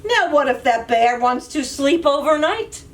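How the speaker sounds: plain reading voice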